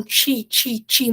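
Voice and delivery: "ti" said as a heavy "tchi"; strong Portuguese ti. Shown as a demonstration. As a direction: repeat "ti" like English "chee", firmly. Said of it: "muito forte".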